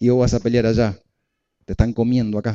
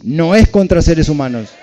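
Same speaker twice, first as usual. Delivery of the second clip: louder and higher, a preacher's emphatic call; proclaimed loudly; 5 semitones higher, 6 dB louder than usual. Shown as a demonstration. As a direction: lift it up and proclaim it to the room. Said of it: "Decí".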